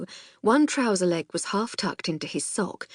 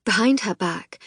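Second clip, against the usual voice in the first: ordinary delivery, nothing marked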